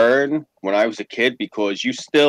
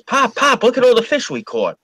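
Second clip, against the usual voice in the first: proud sons; 12 semitones higher than usual. Retold or imitated. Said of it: "they go like this".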